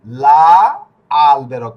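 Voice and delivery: loud LA held, pause, then আলবেরো; teacher sounding out লা ... আলবেরো in two parts; pronunciation demo. Shown as a demonstration. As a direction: model "লা আলবেরো" loudly, an A sound after the L. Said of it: "আমরা উচ্চারণটা কি করব?".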